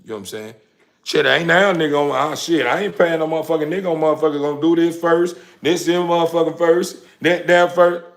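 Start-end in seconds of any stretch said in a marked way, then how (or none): 1.0-8.0 loud, higher mock aggressive rant; imitating an overbearing woman; too-strong demand. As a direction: play a bossy partner barking orders. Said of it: "they just say it too strong".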